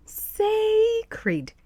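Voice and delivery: "Sacred" with long high SAAAY, dropping on kreed; "Sacred" as two spaced syllables, SAY-kreed; repeat-after-me pronunciation. Shown as a demonstration. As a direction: lead the class in "Sacred", SAY-kreed, stretched. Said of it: "Say it with me".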